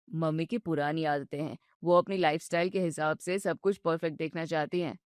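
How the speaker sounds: calm her down patiently; soothing husband. Acted. Husband soothes his upset wife, low and gentle.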